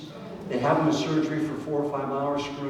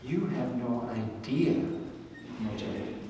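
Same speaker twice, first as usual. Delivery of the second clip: slow, soft, lowered reassurance; a lightly voiced God, softer and slower; God's gentle correction; 3 semitones lower, 7 dB quieter, slower than usual. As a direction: draw it out tenderly. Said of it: "And he goes".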